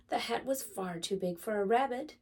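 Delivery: plain reading voice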